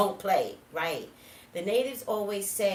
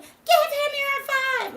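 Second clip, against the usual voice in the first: high, drawn-out scream, retold in full force; 11 dB louder, slower than usual. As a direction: scream it slowly at your stubborn sister. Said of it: "and I'm screaming".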